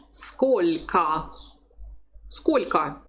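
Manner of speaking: Сколько with soft "l" and final "a"; teacher's model Сколько, "SKOL'-ka". Shown as a demonstration. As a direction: say Сколько carefully, softening the L before "ka". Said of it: "So we say L".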